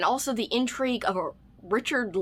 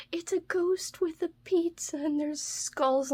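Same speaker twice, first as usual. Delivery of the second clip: whisper; 6 semitones higher than usual. Real, not acted. Whispering about a ghost magnet.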